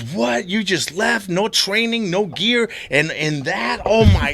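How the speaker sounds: mock jaw-drop disbelief; amazed traveler. Astonished cyclist voiced.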